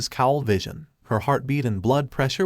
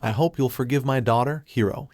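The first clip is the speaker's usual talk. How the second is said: ordinary delivery, nothing marked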